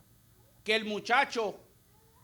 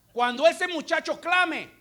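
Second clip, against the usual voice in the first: preaching cry; 7 semitones higher, 7 dB louder than usual. Real, not acted.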